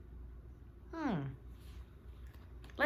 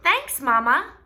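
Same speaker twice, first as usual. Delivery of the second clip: put-on bright, loud little girl voice; cheerful girl voice; 7 semitones higher, 9 dB louder, slower than usual. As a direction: call it out happily. Voicing Sister.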